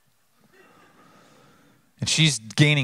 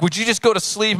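much higher, fed-up pleading father; exasperated plea; 9 semitones higher than usual. Retold or imitated. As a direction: beg the child to sleep, at the end of your rope. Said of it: "and I'm like".

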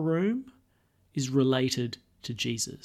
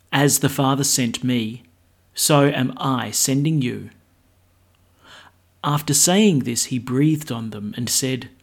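louder formal gospel reading; gospel proclamation; 5 dB louder than usual. Acted.

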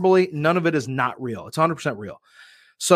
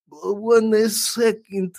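drawn-out, higher mock-Russian stalling; fake Russian accent; 5 semitones higher, much slower than usual. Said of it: "as my character is".

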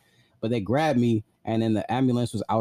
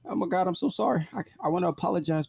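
higher, imitating tearful remorse; retold crying; 9 semitones higher than usual. Running away in tears, mock tearful pleading. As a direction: replay a sobbing apology, pitched up. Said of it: "Tears streaming down my face".

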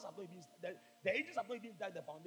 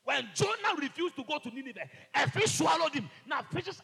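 loud high preaching; preaching shout; 7 semitones higher, 10 dB louder than usual. Real, not acted. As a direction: drive it home loudly. Preaching climax.

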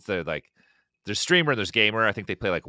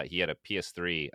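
ordinary talk

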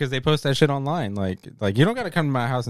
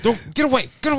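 high, frantic get away; panicked shoo; 13 semitones higher than usual. Retold or imitated. Dad pulling his daughter away from the door panel, frantic. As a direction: wave the kid off in a panic.

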